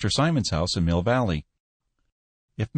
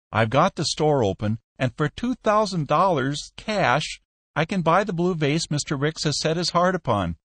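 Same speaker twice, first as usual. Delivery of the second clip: pleading Peck; 7 semitones higher than usual. A narrator's reading.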